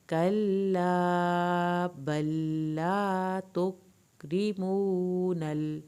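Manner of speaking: slow, drawn-out melodic tajweed recitation; Quran recitation. Shown as a demonstration. Teacher reciting the verse for students, slow melodic recitation. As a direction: chant the verse slowly, stretching every long vowel.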